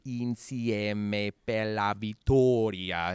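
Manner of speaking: very slow, spelling out "Insieme per la vittoria"; feed it syllable by syllable; slow phonetic Italian. Retold or imitated. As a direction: coach dictating "Insieme per la vittoria" slowly. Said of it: "phonetically, she would say".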